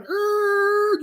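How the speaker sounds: long, high held screech; screeching noise. Retold or imitated. Showing danger about to happen, high drawn-out screech. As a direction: imitate a screech, high and sustained.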